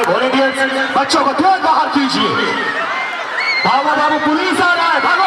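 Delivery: real urgent crowd-control shout; calling out warning. Real, not acted. Clearing children off the ground.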